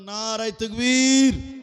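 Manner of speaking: long, high shouted slogan; loud rallying cry. Shown as a demonstration. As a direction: rouse the crowd to shout back. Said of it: "একটু জোরে বলুন তো".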